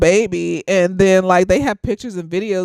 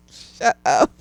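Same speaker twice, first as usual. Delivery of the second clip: normal talking voice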